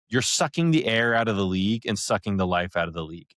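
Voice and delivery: normal talking voice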